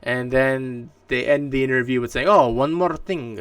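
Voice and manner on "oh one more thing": slow, drawn-out attempt at a Japanese accent, with a slightly raised pitch; a half-hearted Japanese-accented quote, slowed right down; mock Japanese accent. Streamer imitating Kojima's closing tease in a weak Japanese accent. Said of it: "I can't do a Japanese accent".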